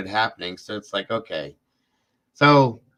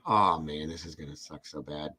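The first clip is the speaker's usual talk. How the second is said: low, much softer, almost under his breath; muttering his dread over the dog's limp body; quiet dread; 7 semitones lower, 8 dB quieter than usual. Retold or imitated. Retelling the moment his dog lay still, low and hushed.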